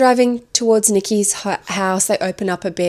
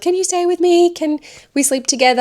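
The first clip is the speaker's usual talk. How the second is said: needy pleading; 11 semitones higher than usual. Retold or imitated. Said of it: "she's like".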